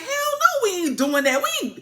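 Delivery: very high-pitched indignant squawk; hammed-up outraged refusal. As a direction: refuse in squealing mock outrage. Acting Aegon's outraged refusal.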